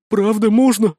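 high, lifted, hopeful question. A narrator's reading. Scared wizard asks to ride on leader's back, hopeful. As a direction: ask eagerly, voice lifting loudly. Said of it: "с надеждой спросил Спирт".

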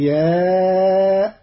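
الأنبياء with a hamza for the ya, al-anbi'a'; hamza reading. Shown as a demonstration. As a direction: say الأنبياء with a glottal stop and a four-beat madd. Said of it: "بهمز مكان الياء". (الأنبياء بالهمز ومد أربع حركات)

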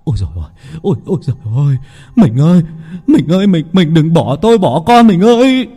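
loud high lament; rising grief-stricken cry; wailing. Acted. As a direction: wail for the lost wife. Husband wailing over wife's sandals.

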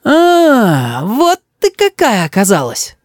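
high, long-stretched accusing realisation; drawn out. A narrator's reading. Boy unmasks his grandmother, drawling reproachfully. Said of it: "тянет Рис".

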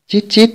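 short high-pitched rodent squeak; narrator's quick imitated squeal; piercing rat squeaks. A narrator's reading. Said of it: "Từng tiếng kêu vang vọng chói tai".

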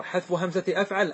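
usual speaking voice

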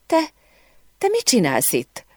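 halting, faltering question; mildly acted stunned stutter; stammering. A narrator's reading. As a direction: stammer lightly, pausing after the first word. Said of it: "dadogta Emily".

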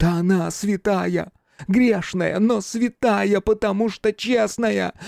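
very high, impassioned exaltation; fervent outburst. A narrator's reading. Man exalting his beloved as a martyred saint, fervent. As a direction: rise to a feverish, worshipful cry. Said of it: "он заговорил пылко и быстро".

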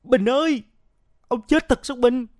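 loud, high cry of grief; anguished outcry. A narrator's reading. Huy kneels by his dead friend, crying out in tears. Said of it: "Anh kêu lên".

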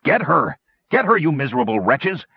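high, urgent yell at the goblins; the master screams at his goblins to attack; yelling orders. A narrator's reading. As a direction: shout them into the attack. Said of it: "yelled the man from the shadows".

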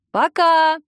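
громкое звонкое «Пока!» вслед; выкрикнуть прощание через дверь; восклицание. A narrator's reading. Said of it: "воскликнула я". (громко крикнуть «Пока!» через закрытую дверь)

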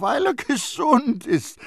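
high, loud, stretched exclamation; exasperated cry; calling out. A narrator's reading. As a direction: repeat it emphatically at a shout. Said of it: "rief Terrier".

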